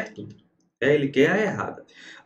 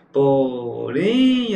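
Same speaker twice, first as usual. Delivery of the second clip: Porém drawn out, rising loudly on "rém"; Porém with a long, swelling "rém"; stress on the final syllable; 4 dB louder than usual. Shown as a demonstration. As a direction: stretch and punch the last syllable of Porém. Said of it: "a sílaba tônica é a última".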